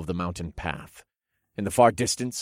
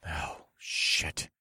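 whispered curse under breath; muttered; 3 semitones lower, 6 dB quieter than usual. Acted. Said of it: "I muttered under my breath".

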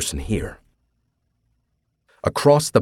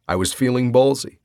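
usual speaking voice

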